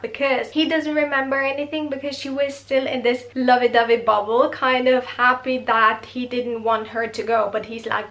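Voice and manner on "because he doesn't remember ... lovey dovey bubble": usual speaking voice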